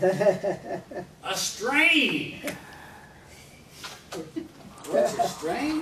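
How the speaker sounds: put-on high, loud confused old man's shout; loud puzzled grandfather voice; deaf grandpa. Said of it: "my grandfather".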